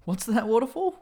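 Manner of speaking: acted confusion, voice lifted high; baffled question. Mishearing a mate in a sponsor skit, baffled.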